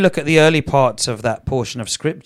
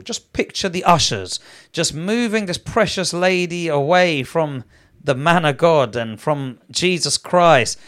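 normal talking voice

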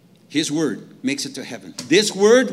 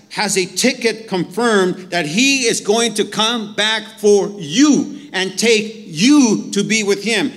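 louder, slow emphatic preaching; fervent preaching; 3 semitones higher, 6 dB louder, slower than usual. Acted. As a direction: drive the promise home to the church.

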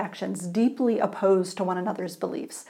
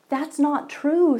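reassuring; 6 semitones higher, 3 dB louder, slower than usual. Acted. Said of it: "said the other man".

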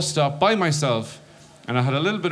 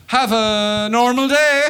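very slow, much higher and louder; forced normal; 11 semitones higher, 6 dB louder, much slower than usual. Retold or imitated. Stoned guy leaving ticket desk, slow high forced cheer. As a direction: overdo sounding normal. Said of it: "I better slip it in again".

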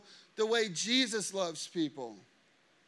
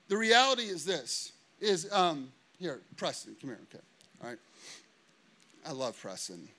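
ordinary talk, nothing marked

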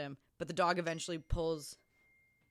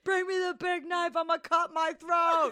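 dramatic actor; 9 semitones higher, 4 dB louder than usual. Retold or imitated. Mocking the actor demanding the big knife, hammed-up and higher. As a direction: overact it like a B-movie hero. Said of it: "and he goes".

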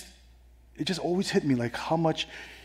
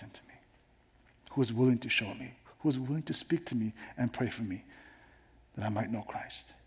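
quiet prayer; 5 dB quieter than usual. Acted. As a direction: lead a hushed prayer, voice sinking softer. Leads a prayer of thanks.

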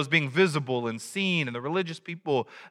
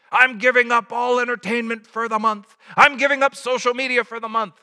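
mock announcing; 6 semitones higher, 4 dB louder than usual. Quoting social media fast posts.

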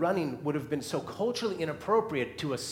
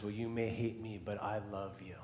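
softer, gentle and sincere; tender; 4 semitones lower, 9 dB quieter than usual. Retold or imitated. Heartbroken mother answering her child, tender and soft. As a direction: quietly reassure the child.

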